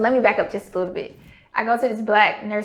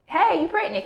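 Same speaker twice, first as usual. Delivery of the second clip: much higher, bright excited question; excited nurse; 9 semitones higher, 3 dB louder than usual. Retold or imitated. Nurse coming into the room, imitated excitement. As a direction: burst in thrilled at the news. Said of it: "she's excited".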